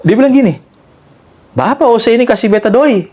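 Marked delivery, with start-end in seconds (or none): 1.5-3.1 high-pitched imitated Ambonese accent; raised voice pitch with an Ambonese lilt. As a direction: mimic an old man talking in Ambonese dialect. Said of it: "Pakai logat Ambon kan".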